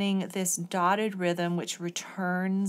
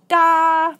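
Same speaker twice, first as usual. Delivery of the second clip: loud accent demo; 10 semitones higher, 16 dB louder than usual. Shown as a demonstration. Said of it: "just have it loud".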